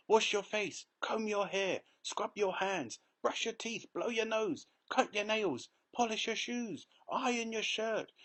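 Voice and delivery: high, rapid-fire list of orders; excited old man. A narrator's reading. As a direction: rattle off the chores with glee. Grandpa Joe excited orders.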